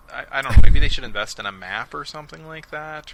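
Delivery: loud, exasperated rant; loud fed-up commentary; exasperated outburst. Real, not acted. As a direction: blurt it out in disbelief.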